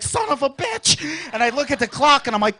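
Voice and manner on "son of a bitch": startled curse. Retold or imitated. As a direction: swear as the alarm hits. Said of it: "I'd wake up like".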